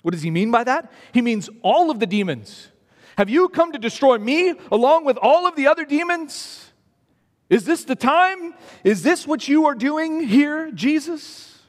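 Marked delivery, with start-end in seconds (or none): 3.2-11.6 higher, anxious pleading demon; a frightened demon begging Jesus; fearful demon. Acted. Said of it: "the sense of fear that the demons have".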